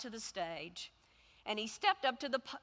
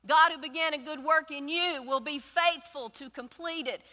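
higher and louder declaration; preaching the verse at full force; proclaiming; 5 semitones higher, 7 dB louder than usual. Acted. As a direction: proclaim it to the church. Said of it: "that goes like this".

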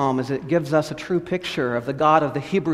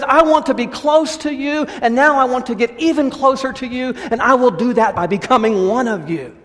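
higher, louder voice of God speaking; 6 semitones higher, 3 dB louder than usual. Acted. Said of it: "That's a God who says".

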